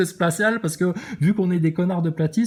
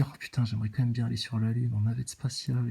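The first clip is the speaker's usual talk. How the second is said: softer and lower, daydreaming as he falls asleep; dreamy inner voice; 5 semitones lower, 6 dB quieter, faster than usual. Retold or imitated. Mocking a viewer's dreams of space, soft and dreamy. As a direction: murmur it like a child's night wish. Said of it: "puis tu te dis".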